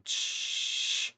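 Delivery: long hissed cymbal crash; imitated drum roll. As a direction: make a drum roll with the mouth. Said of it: "you hear a drum roll go".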